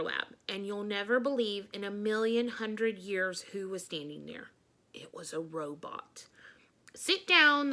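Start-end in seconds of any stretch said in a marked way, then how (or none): 4.9-6.3 hushed reveal; dramatic whisper. Acted. 6.9-7.7 high, loud robotic command; computery robot voice. Acted. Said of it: "commanded in a computery voice".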